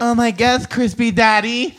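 high, loud, slow gushing tease; teasing friend mid-story, high slow mock swoon; mock swooning girl. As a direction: coo it like a smitten date. Mocking the date's gushing reply.

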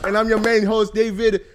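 loud, high announcer voice; hyped self-introduction. Real, not acted. Host introducing himself to viewers, loud hype. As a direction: announce yourself with big show energy.

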